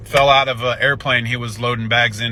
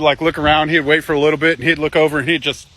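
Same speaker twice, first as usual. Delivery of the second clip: ordinary talk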